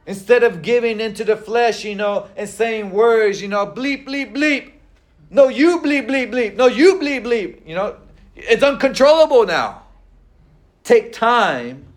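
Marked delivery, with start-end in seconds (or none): 3.7-7.7 hammed-up bleeped swearing, higher; mock angry cursing. A mock angry swearing match, voiced higher. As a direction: play both sides of a cursing fight.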